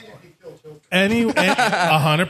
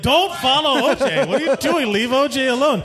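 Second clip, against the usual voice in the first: high, pleading and laughing; panicked plea; 6 semitones higher than usual. Retold or imitated. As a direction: beg him not to follow, half laughing. Retold panicked plea to friend.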